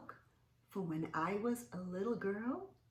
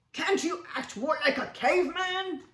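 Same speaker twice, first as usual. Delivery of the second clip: loud, higher caveman taunt; cavemen; 10 semitones higher, 10 dB louder than usual. Acted. Cavemen pressuring Stanley, loud taunting caveman voice. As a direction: taunt him as a pushy caveman. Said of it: "they asked".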